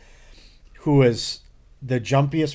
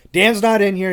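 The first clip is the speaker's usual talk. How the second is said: higher, louder, worked-up insistence; fired-up protest; 8 semitones higher, 6 dB louder than usual. Retold or imitated. Panicked coworker insisting to Ben, fired up. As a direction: push back hard and fast.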